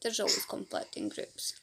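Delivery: hushed confiding aside; whispered aside. Real, not acted. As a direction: drop to a secret whisper. Whispered aside about conflict.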